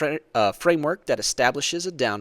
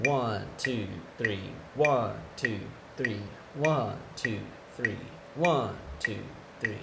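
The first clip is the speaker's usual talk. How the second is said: even counting on every beat, softer; quarter note count; 3 semitones lower, 6 dB quieter, slower than usual. Shown as a demonstration. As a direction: count each beat evenly. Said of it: "one note on every beat".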